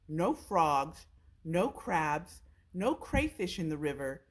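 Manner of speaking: usual speaking voice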